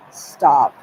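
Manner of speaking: "Stop." yelled loud and short; shouted stop. Retold or imitated. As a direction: shout "Stop." to halt everything. Stan's retold command.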